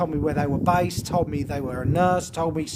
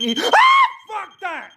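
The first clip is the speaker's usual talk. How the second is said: sudden shout, much higher; startled shout; 14 semitones higher, 7 dB louder, slower than usual. Real, not acted. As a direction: jump and yell in fright. Sudden fright in dark.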